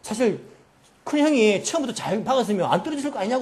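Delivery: heated mock sibling row; angry quarrelling. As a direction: act out the youngest brother throwing back the blame. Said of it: "막 서로 막 싸우게 된 거예요".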